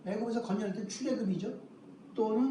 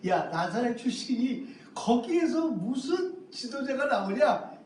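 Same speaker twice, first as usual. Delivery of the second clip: louder, higher mocking challenge; sarcastic scoffing; 3 semitones higher, 3 dB louder than usual. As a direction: scoff at the very idea, imitating a sceptic. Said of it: "이렇게 빈정거렸던". (비꼬며 빈정대는 말투)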